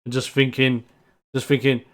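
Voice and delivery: ordinary delivery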